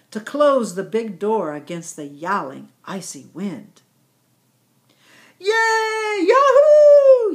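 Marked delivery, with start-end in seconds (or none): 5.4-7.3 very high, loud yahoo; yelled cheer. A narrator's reading. Thankful yaks cheering the closed door, high loud yell. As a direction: cheer in triumph. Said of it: "yelled all the warm, thankful yaks".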